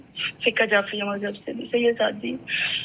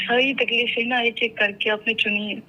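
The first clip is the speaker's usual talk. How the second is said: usual speaking voice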